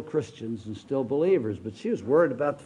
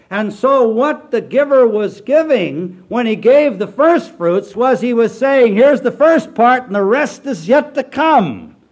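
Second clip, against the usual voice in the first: loud, high, slow pulpit proclamation; full-force revival preacher; preaching at full force; 8 semitones higher, 10 dB louder, slower than usual. Acted. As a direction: thunder the scripture out slowly.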